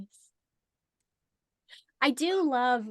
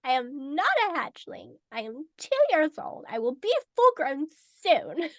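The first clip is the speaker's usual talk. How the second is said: high, loud, emphatic little protest; indignant young hatchling; 4 semitones higher, 5 dB louder than usual. Retold or imitated. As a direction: protest every word like an offended youngster. Plays hatchling insisting she is grown.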